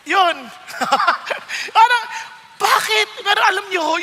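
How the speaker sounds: usual speaking voice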